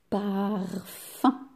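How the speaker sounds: parfum said as par, then fum, slowly; split syllables. Shown as a demonstration. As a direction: separate the two beats of parfum. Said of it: "Combien de syllabes?".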